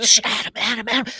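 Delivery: urgent shushing; loud shushing. Retold or imitated. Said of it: "And you're all like".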